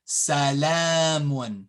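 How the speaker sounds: salamun, slow with a long la; long vowel. Shown as a demonstration. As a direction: stretch the middle a of salamun. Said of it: "read when you say it".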